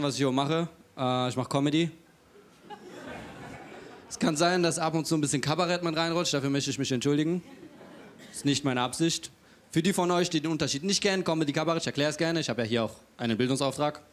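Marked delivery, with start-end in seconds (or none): none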